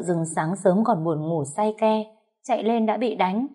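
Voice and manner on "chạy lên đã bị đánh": ordinary delivery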